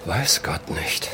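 very slow, low and soft; sinking into a hushed, drawn-out afterthought; pensive echo. Acted. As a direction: repeat it quietly to yourself. Repeats it to himself, pensive.

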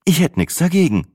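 high, lightly amused reply; amused smirk. A narrator's reading. Sina eyeing the muscular stranger, amused. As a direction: say it with a sly smile. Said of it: "schmunzelte Sina".

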